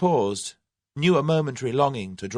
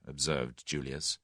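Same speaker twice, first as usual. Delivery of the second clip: usual speaking voice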